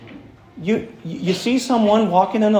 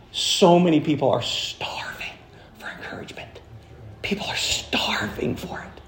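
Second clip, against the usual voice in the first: hushed whisper; 6 dB quieter than usual. Real, not acted. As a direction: lean in and whisper the need. Preacher drops to whisper.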